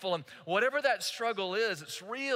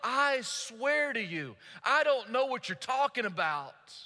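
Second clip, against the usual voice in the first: higher, drawn-out sworn protest; emphatic, almost animated; 5 semitones higher, slower than usual. Acted. Peter's third denial to his accuser, emphatic and animated. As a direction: swear it emphatically, stretching the opening words. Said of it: "Peter gets real emphatic, almost animated".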